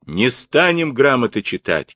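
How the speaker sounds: protesting yell from a crowd; crowd shout. A narrator's reading. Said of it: "кричали казаки".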